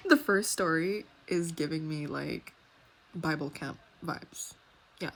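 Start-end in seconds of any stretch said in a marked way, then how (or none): none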